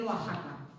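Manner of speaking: "Oaxaca" as wa-JA-ka; "Oaxaca", its X pronounced as a J. Shown as a demonstration. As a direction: say "Oaxaca" with a throaty Spanish J. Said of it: "que se tiene que decir".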